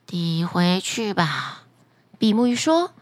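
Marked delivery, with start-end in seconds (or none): 0.1-1.6 deep, slow magic fish; a low, unhurried talking fish; flounder voice. Acted. Magic flounder answering the fisherman, deep and slow.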